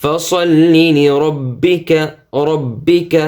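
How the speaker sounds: slow tilawat; Quran recitation. Shown as a demonstration. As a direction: intone لربك melodically. Recites the verse.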